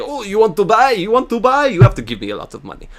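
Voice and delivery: high, wheedling mock shopkeeper voice that drops for the price; greedy merchant. Mocking the jerk merchant who sells the ferry, greedy voice. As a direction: ham up a pushy merchant haggling.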